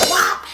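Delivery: loud sudden "whop" of a blow; punchy vocal hit sound effect; imitated smack.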